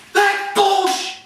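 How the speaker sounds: high, cut-off cursing outburst; coach freaking out. Retold or imitated. Coach cursing the officials, outraged and cut short. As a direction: imitate a coach exploding at a call. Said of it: "they were freaking out the officiating".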